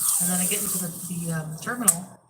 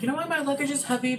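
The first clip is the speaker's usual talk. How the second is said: playful question; 7 semitones higher, faster than usual. Retold or imitated. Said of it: "I'm like".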